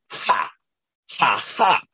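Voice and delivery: spaced-out fake laugh; deadpan ha ha, one beat each; staged laugh for a catchphrase; mock ha ha. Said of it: "I'm like".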